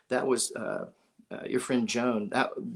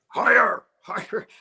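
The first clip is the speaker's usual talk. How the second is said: retold yell; 8 semitones higher, 9 dB louder than usual. Ed yelling to jump.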